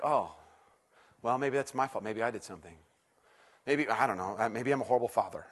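low, soft, deflated voice; dejected self-doubt. Retold or imitated. Dad doubting himself after his kid's horrible game, dejected self-doubt. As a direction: sink into quiet self-blame.

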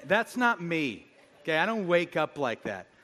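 normal talking voice

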